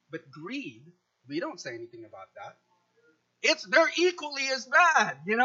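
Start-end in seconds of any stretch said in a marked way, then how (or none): 0.1-2.6 shrugged-off hush. Retold or imitated. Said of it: "selective indignance".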